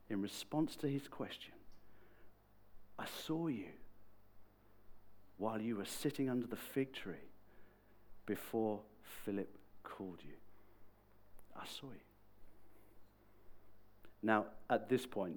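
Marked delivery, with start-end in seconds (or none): 3.0-12.0 softly measured, long pauses; calm. Retold or imitated. Jesus answering Nate's question about knowing him, calm. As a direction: let it sink in quietly and gently. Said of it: "Jesus so calmly says".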